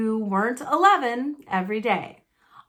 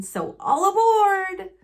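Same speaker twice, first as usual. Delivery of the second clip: much higher, slow, loud call; hearty Uncle Vernon; 10 semitones higher, 5 dB louder, slower than usual. Acted. Uncle Vernon calling the family aboard the boat, loud and slow. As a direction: sing out the order.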